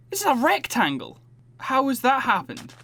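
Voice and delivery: can't believe your own mistake; exasperated disbelief. Real, not acted. Disbelief at miscounted blocks.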